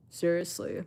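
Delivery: usual speaking voice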